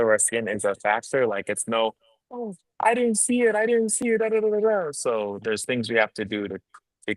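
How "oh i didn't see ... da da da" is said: very high mocking falsetto. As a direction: squeak it out, mocking them. Mocking colorblind people.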